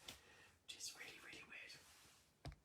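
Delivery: hushed, slow whisper of disbelief; whispered aside. Real, not acted. Host whispering to viewers that the new bottles are weird. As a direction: drop to a conspiratorial whisper.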